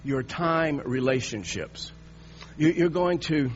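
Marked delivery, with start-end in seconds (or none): 0.0-1.9 "your time relationships" drawn out slowly, every word weighted; slow and deliberate. Shown as a demonstration. Preacher stressing a phrase to the congregation, slow and deliberate. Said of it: "you hear how I'm going to say this".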